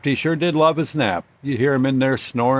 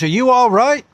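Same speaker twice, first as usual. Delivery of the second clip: higher, louder worried question; anxious check on a bystander; concerned cop; 9 semitones higher, 5 dB louder than usual. Retold or imitated. Cop asks the just-woken father if he is hurt, alarmed.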